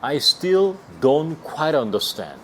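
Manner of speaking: very slow, every word spaced; teacher modelling slowly; slow demo. Shown as a demonstration. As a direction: spell it out for learners. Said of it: "이렇게 하면".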